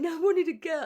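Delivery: high, loud, whiny wail on wanted; retold tearful protest. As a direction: protest through tears like a disappointed mum. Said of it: "And I'm going".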